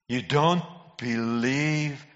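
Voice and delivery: drawn right out, word by word; slow incredulity. Real, not acted. Preacher repeating it to born-again Christians, slowly incredulous. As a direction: stretch each word in disbelief. Repeated much slower for disbelief.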